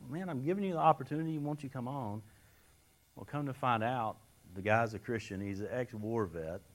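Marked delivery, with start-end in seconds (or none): 0.1-2.2 higher, fast, mock-exasperated; frustrated plea. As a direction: rush it like you're losing patience with him. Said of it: "we all do this".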